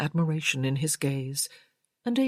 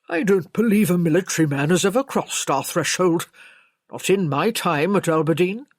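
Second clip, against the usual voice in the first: louder, stuffy servant's voice; indignant senior servant; 6 dB louder than usual. A narrator's reading. Servant disputing any soldiers in the house, indignant. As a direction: defend the household's record firmly.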